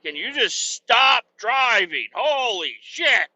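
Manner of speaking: high, drawn-out mock despair; exasperated plea. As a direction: beg the driver to stop, fed up. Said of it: "You're like".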